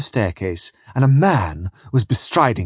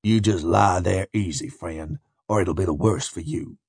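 quiet, low menacing growl; 5 semitones lower, 5 dB quieter than usual. A narrator's reading. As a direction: growl a soft threat at a captive. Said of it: "and growled".

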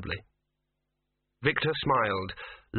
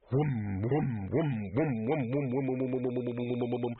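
humming whom. A narrator's reading. Vase humming sound.

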